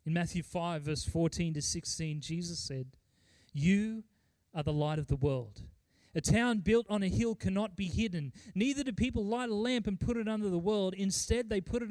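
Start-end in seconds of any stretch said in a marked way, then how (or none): none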